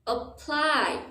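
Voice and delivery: APPLY as quick uh, then high long PLY; stress on second syllable. Shown as a demonstration. Teacher contrasting two words, apply with stressed PLY. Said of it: "stress is on the second syllable".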